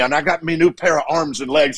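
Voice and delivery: put-on elderly Irish priest accent; Irish brogue. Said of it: "he said it in his beautiful Irish brogue".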